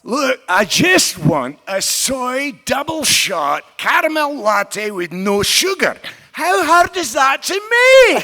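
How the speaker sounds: slow, loud, high outraged dwarf shout; Bran ordering angrily. Acted. Bran shouting at a Starbucks barista over his latte. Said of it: "I wanna hear Bran order".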